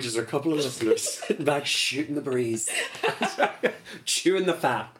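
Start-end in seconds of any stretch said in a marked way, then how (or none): 2.7-4.0 high breathy laugh. Real, not acted. Laughing at own joke.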